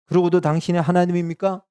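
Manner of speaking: mock accusation. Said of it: "막 욕하고 저주하겠습니까".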